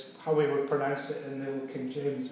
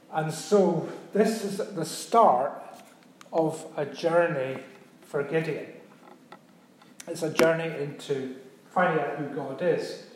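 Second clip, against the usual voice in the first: ordinary talk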